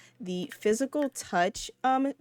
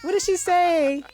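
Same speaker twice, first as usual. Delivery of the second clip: excited mom; 11 semitones higher, slower than usual. Retold or imitated. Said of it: "She's like".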